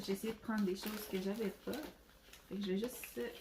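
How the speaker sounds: usual speaking voice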